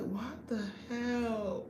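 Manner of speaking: slow, dragged-out what the hell; groggy disbelief. Retold or imitated. Waking to the alarm, slow groggy disbelief. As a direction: stretch it out like you're half asleep. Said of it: "I be like".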